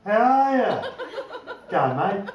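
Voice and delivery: clipped, lazy Aussie "How are ya?" run together; broad Aussie mate. Retold or imitated. Mate Jono greeting him in Darlo, broad Aussie slur. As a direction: mimic a mate's slurred Australian greeting. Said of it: "the conversation so far is".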